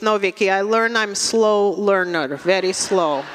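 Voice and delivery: put-on Russian-accented mom, deadpan correction; Russian mother. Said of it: "And she goes".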